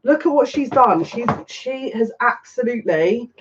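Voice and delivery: high-pitched, amazed excitement; thrilled and still in shock; excited shock. Real, not acted. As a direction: marvel at it, voice lifted. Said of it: "Honestly, I'm still in shock".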